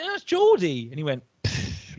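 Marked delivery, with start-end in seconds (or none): 0.0-0.9 very high, loud "That's Geordie" retort; exasperated high protest. Retold or imitated. Comic telling the Irish comedian it was Geordie, high exasperated retort. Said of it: "I went". 1.4-2.0 soft unvoiced scoffing puff; dismissive pfft. Offended comedian brushing off the comic, soft scoffing pfft. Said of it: "like that".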